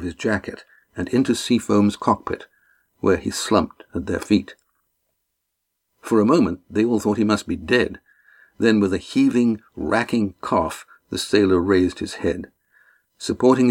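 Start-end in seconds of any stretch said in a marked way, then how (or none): none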